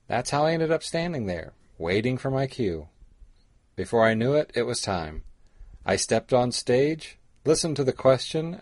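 usual speaking voice

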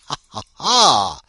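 loud, slow "ha ha ha"; big mock laugh. Host laughing at his own party-crashing tip, hammed-up. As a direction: laugh it out hugely, last one biggest.

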